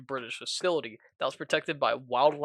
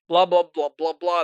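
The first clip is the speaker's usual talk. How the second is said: loud, higher dismissive blah blah; 4 semitones higher, 10 dB louder than usual. Real, not acted. Narrator waves off the ending, loud and dismissive. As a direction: brush it all off loudly. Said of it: "trailing off summary".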